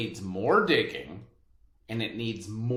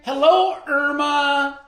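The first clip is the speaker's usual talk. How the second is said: loud, high call across the yard; mother greeting the neighbor across the garden, calling out loud; 11 dB louder than usual. Acted.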